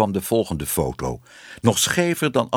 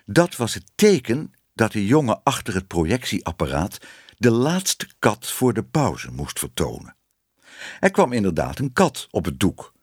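plain reading voice

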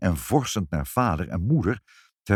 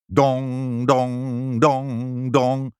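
resonant, higher-pitched dong, evenly repeated; imitated clock strikes; 5 semitones higher, 3 dB louder, much slower than usual. A narrator's reading. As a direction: toll like a big clock. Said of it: "sloeg de klok".

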